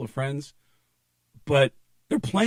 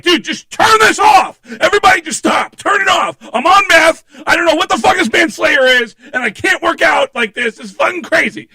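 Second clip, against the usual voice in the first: panicked yelling; 14 dB louder than usual. Retold or imitated.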